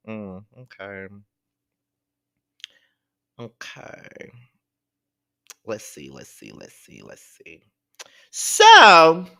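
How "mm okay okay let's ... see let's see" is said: soft, low muttered let's see, fading to a whisper; muttering to self. Real, not acted. Host scrolling tweets, muttering to self, fading to whisper. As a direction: mumble it while scrolling.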